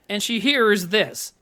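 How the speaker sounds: ordinary talk, nothing marked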